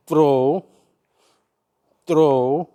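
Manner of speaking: loud, rising coaching calls on each throw; rhythm calls. Shown as a demonstration. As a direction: call out each throw in time with the swing. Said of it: "and then throw".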